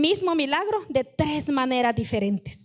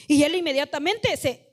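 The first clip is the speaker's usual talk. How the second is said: ordinary talk, nothing marked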